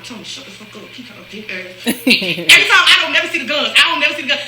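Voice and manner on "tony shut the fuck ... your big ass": much softer, low hushed scold. Retold or imitated. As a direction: hiss it under your breath at Tony. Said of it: "I hear Nudie arguing".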